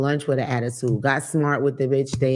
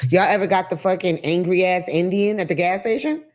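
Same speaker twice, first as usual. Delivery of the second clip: ordinary talk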